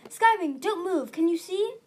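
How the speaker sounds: high, loud alarm; urgent call. A narrator's reading. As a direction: call out urgently to the injured one. Urgent call to an injured dragon.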